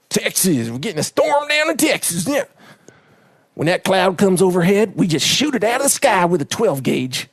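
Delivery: exaggerated Texas accent, starting high; cartoon Texas voice. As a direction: play a boastful cartoon Texan. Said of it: "I do a Texas voice too".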